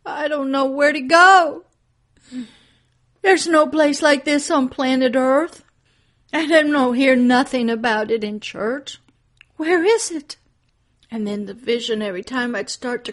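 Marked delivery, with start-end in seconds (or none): 0.0-10.4 high, loud, pleading refusal; anxious protest. Retold or imitated. Preacher telling God she doesn't know where to go, frantic. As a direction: plead that you can't do it. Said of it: "protesting to God in vision".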